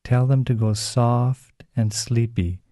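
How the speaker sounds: ordinary talk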